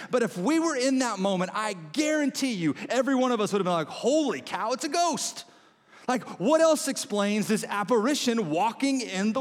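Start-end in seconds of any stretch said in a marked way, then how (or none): 3.9-5.4 high-pitched mock panic, rising on GHOST; acted alarm, pitched way up; startled fright. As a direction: yelp it like a spooked witness. Imagined panic at seeing ghost.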